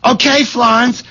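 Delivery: high-pitched shout back to the next room; calling back. Acted. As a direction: answer a yelling wife from afar. Calling back to his yelling wife.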